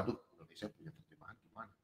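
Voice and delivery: softly mimicking puzzled foreign readers; mocking foreigners. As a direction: toss it off fast and soft like a puzzled outsider. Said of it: "mereka bilang".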